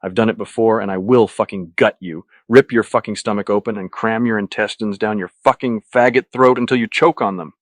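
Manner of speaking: loud, spat-out snarl; snarling threat. A narrator's reading. Patrick yanking Luis's head up, loud snarling threat. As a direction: spit the threat out forcefully. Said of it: "snarling, I spit out".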